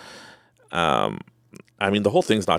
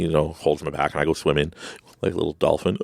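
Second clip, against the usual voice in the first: ordinary talk, nothing marked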